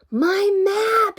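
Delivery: high, loud cry of dismay; drawn-out horrified shout; shocked yell. A narrator's reading. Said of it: "he yelled".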